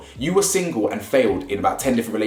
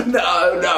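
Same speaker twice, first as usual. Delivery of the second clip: a hammed-up gasp, then a higher "no, no"; imitated dramatic gasp; 7 semitones higher than usual. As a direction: mimic her over-the-top reaction. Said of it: "she did the same thing again today".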